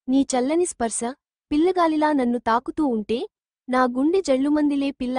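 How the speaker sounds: usual speaking voice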